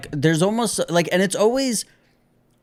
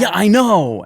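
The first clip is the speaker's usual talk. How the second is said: retold snapping; 5 semitones higher, 6 dB louder than usual. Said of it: "you snapped back at me like".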